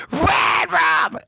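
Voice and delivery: high, loud gassy screech; pushed screechy scream. Shown as a demonstration. Singer demonstrating scream technique, pushed screech. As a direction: put gas behind a tightened throat and push. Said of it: "you push it, so you're going".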